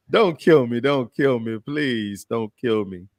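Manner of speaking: playful begging for mercy, dragged out at the end; mock pleading. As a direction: beg jokingly, slowing on the last plea. Mock pleading for his life.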